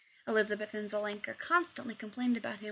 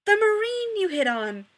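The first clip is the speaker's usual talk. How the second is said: yelling; 11 semitones higher, 11 dB louder than usual. A narrator's reading. Rodney yelling, waving his arms.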